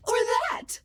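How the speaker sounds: squeaky high voice demo; a bright voice sample pitched high. Shown as a demonstration. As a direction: jump way up in pitch. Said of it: "I can sound like anyone".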